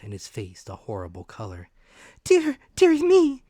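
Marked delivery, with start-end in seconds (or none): 2.2-3.4 very high, loud fretful cry; distressed mother wails. A narrator's reading. Frightened mother cries out over the fallen captain. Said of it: "cried mother".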